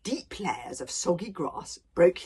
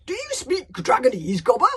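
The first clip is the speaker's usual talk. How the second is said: higher, louder questioning chief; surprised; 4 semitones higher, 7 dB louder than usual. Acted. Stoic questions Gobber about speaking Dragonese, surprised. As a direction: ask in open surprise. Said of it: "asked Stoic in surprise".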